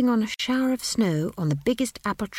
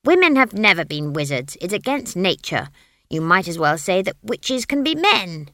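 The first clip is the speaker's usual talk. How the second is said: indignant witch; 7 dB louder than usual. A narrator's reading. Witch lectures tree.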